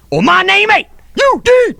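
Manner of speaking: high, loud mock boast; hammed-up high-pitched holler; showman hollering. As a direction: holler it out like a hype man. Showman's hype chant.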